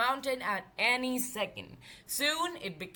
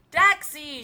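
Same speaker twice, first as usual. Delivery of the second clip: calling out loud and high; yelled taxi; 6 semitones higher, 10 dB louder than usual. Acted. Nellie hailing a taxi driver, loud high call. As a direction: hail the cab across the street. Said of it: "she yelled".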